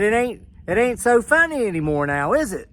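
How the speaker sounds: smug triumphant jab; gloating taunt. Retold or imitated. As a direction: needle him with a gloating grin. Gloating at official.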